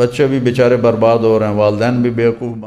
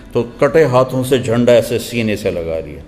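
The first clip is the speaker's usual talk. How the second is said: usual speaking voice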